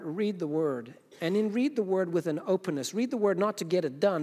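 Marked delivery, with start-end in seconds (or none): none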